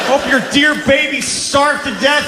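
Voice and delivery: high-pitched ranting yell, full of spite; re-enacted furious tirade, strained and high; yelling into woods. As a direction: hurl curses at a dead enemy. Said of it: "I yelled into the woods".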